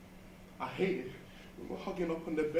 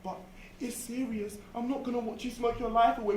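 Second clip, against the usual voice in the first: mimicking mum; 7 semitones higher than usual. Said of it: "She was all la-di-da".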